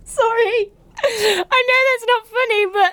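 high, breaking through giggles; laughing apology. Real, not acted. Apologising to the commenter while laughing uncontrollably. Cracking up at reader's accident.

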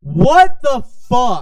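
slow, loud drawn-out outrage; outraged. Real, not acted. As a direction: stretch it out in disbelief. Outraged at character's enslavement.

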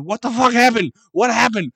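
loud, high mock yell of disbelief; shouting at a security guard after missing the play, high and loud; retold yell. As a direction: yell it like you just missed the big play. Retells yelling at security guard.

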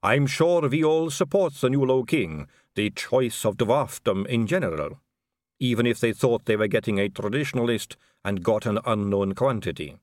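put-on measured, accented vampire aristocrat; smooth vampire lady. Vampire lady giving Vimes the diplomatic line, smoothly.

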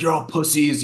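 high-pitched imitation of a jeering fan; mock scream. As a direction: throw it out like a heckler, not at full volume. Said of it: "screaming at us".

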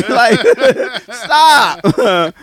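laughing high and loud; words broken by laughter. Real, not acted. Laughing through the retold story.

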